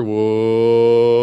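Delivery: long, steady, playful whoa; slow suspenseful whoa; drawn-out whoa. Shown as a demonstration. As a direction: stretch the whoa as your hand comes closer. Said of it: "But if I come over and say".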